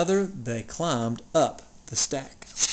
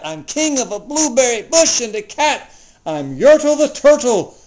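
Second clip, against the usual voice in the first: higher, louder gloating; boastful king; 5 semitones higher, 5 dB louder than usual. Acted. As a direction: crow over everything you rule. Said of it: "Yertle cried".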